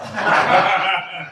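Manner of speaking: big loud laugh, far off the mic; loud burst of laughter. Real, not acted. Teacher laughing at his own teen impression, loud laugh.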